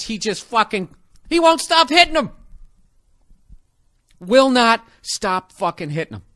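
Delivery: ordinary delivery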